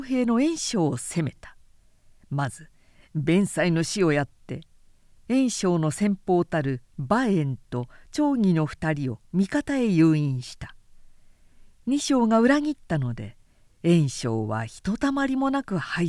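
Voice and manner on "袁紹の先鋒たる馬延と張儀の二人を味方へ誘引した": usual speaking voice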